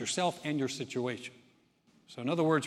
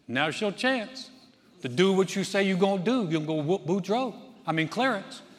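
the wife egging him on in a light Cajun voice, higher; Cajun accent; 4 semitones higher, 4 dB louder than usual. Acted. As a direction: Marie urging her husband in a light Cajun accent. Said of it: "excuse my Cajun accent".

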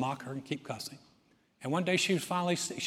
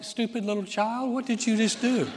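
higher, louder mock scolding; scornful mom; 7 semitones higher, 5 dB louder than usual. Mom's real thought about the child, mock high scolding. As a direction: sneer it as the hidden meaning. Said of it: "That's like".

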